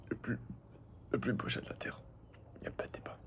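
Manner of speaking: soft unvoiced whisper. Real, not acted. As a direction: whisper it like a secret. Drops to whisper for cat.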